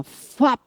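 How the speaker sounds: spoken swoosh sound effect; a quick "fuap" for something shooting up; imitated whoosh. Wife shows platelets shooting up, imitated whoosh. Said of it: "A plaqueta subiu, assim, ó".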